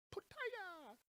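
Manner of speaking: high-pitched mock martial-arts kick cry; high, light karate-style yelp; mock kickboxing cry. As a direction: squeal out a pretend kick shout, softly.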